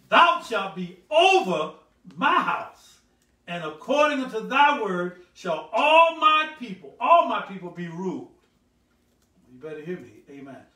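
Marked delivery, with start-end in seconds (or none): 0.1-3.0 very loud, drawn-out scripture proclamation; slow, ringing, raised-pitch verse; slow reading. Shown as a demonstration. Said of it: "I'm gonna read it slow". 3.5-8.3 loud, slow, high scripture proclamation; booming, measured Bible reading. Shown as a demonstration. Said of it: "I'm gonna read it slow".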